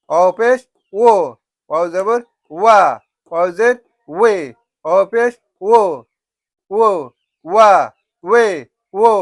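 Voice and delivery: loud teacher drill of ওয়াও পেশ ও, ওয়া, ওয়ে; loud drill calling ও ওয়া ওয়ে; harakat drill. Shown as a demonstration. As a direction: call out each vowel sound for pupils to echo. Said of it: "দেখো".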